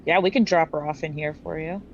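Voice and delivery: ordinary talk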